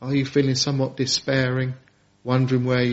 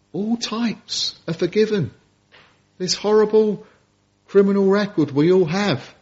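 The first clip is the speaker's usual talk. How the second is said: impassioned preaching; 4 semitones higher than usual. Acted. Preaching rises in pitch.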